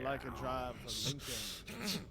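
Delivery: low, soft, very slow impression; Matthew McConaughey. Imitating the actor selling a car, low slow drawl. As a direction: draw it out low and lazy. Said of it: "Matthew McConaughey sounds like this".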